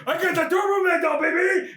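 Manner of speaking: high, hammed-up victory cry; whooping mock triumph, pitched high; triumphant shout. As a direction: crow loudly over a prize. Acting out triumphant Arnold.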